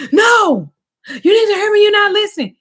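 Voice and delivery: high, loud angry shouting. Retold or imitated. Boyfriend shouting at her that she isn't listening, loud and high. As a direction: yell it at her, demanding to be heard. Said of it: "stop raising your voice at me".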